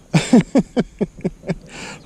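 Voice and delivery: high disbelieving laugh; exasperated laugh. Real, not acted. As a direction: laugh at your own blunder. Forgot paddles, exasperated.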